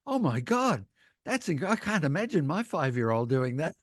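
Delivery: high, horrified gasp of disbelief; a New York parent aghast at kids punching sharks; shocked New Yorker. Retold or imitated. New Yorkers react to the shark story, high and shocked. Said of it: "all these New Yorkers are going".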